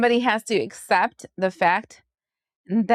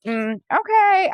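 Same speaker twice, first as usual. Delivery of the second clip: sarcastic okay; 8 semitones higher, slower than usual. Retold or imitated. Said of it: "But then you're like".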